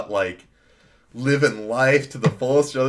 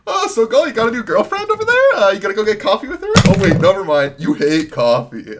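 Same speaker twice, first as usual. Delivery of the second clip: mocking boss, much higher and louder; teasing boss; 10 semitones higher, 9 dB louder than usual. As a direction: rib him like a gleeful boss. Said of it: "even my boss is like".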